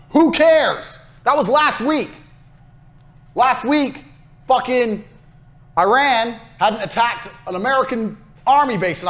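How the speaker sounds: scornful outburst. Real, not acted. Scorning last week's boast.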